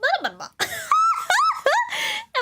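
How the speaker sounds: high squealing laugh fit; shrieking laugh. Real, not acted. Laughing fit at mom's reply.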